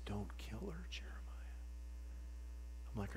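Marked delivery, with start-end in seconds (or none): 0.0-1.6 whispered, much softer; still small voice. Retold or imitated. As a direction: breathe it as a gentle inner whisper. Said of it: "the still small voice".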